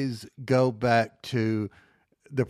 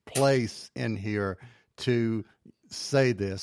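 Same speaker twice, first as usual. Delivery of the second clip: normal talking voice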